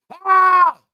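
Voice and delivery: sudden loud, high cry of shock; startled yell. Acted. Mock-shocked reaction to a photo.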